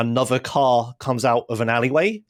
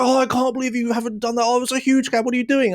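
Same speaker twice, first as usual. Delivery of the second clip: scolding instructor; 10 semitones higher than usual. Retold or imitated. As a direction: mimic her outraged nagging.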